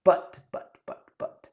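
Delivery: halting, faltering buts as if too moved to go on; mock choked up. Host thanking listeners, mock choked-up stammer. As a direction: pretend to be overcome, stammering. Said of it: "maybe I'm getting a little, uh, emotional here".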